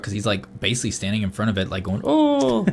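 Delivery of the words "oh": very high, put-on joke Indian voice; mock foreign gibberish. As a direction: squeal it as a badly faked Indian imitation. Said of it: "It wasn't even an Indian accent".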